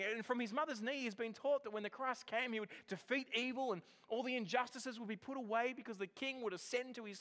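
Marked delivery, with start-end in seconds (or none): none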